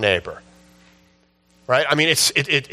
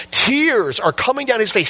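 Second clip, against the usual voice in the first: ordinary talk, nothing marked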